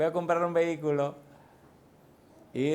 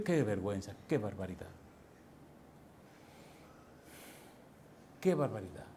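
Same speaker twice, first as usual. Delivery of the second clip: low dismayed sigh; 7 semitones lower, 11 dB quieter than usual. Real, not acted. Preacher laments shame.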